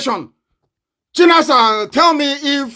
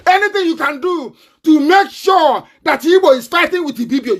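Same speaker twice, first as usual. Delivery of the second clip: angry shout; 8 semitones higher than usual. Real, not acted. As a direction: hurl the accusation at them. Ranting accusation at Yoruba listeners.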